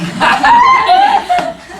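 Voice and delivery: bursting out laughing; big high cracking laugh; loud laugh. Real, not acted. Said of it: "Try it".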